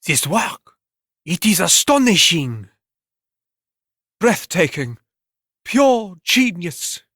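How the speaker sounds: high, breathless critic gushing over the work; awed art critic. Acted. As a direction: marvel at it like a snobbish critic, ending very high and slow.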